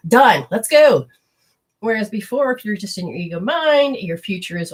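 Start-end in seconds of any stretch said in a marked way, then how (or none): 0.1-1.1 breezy excitement. Retold or imitated. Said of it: "they go".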